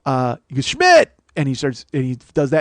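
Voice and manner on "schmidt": high, loud jeering yell; sudden mock call, pitched way up; taunting shout. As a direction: holler the surname like a heckling schoolmate. Schoolmate repeats the taunting call.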